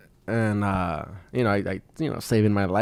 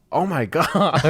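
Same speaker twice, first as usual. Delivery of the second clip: higher, laughing oh my God; mock alarmed mom; 5 semitones higher, much slower than usual. Mom home from the gym finding him crying, mock alarm. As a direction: mom startled, half laughing. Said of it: "she was like".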